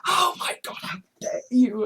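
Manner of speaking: whispery, breath-heavy gasp of indignation; anxious outrage. Retold or imitated. Anxious commenter replying every moment, whispered outrage. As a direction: imitate a frantic commenter under the breath. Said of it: "Anxiously commenting every".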